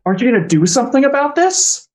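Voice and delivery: high, quick, incredulous question; pleading demand. Acted. Helen looks at Jared for help, high urgent appeal.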